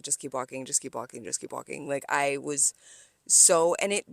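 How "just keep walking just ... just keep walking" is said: quiet repeated mantra under breath; soft low self-talk, barely voiced; muttered to self. Telling herself quietly to keep walking, muttered under breath. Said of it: "So I was just like".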